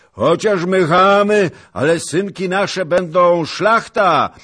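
high, full-force jeering yell; loud, scornful hollering; shouted taunts. A narrator's reading. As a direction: hurl insults across a battlefield. Enemy troops hurl insults back.

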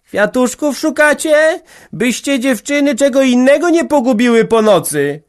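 high, loud teasing call from the window; elders shouting from windows. A narrator's reading. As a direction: yell a mocking joke out to the youngsters. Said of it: "starsi krzykali im z okien".

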